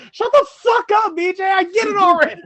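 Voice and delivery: loud high yell at BJ; retold howl. Friend in the car yelling at BJ to shut up, loud and high. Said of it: "Caleb just howled".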